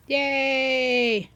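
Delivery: high, drawn-out little cheer; playful cheer. Real, not acted. Cheers after naming the cow.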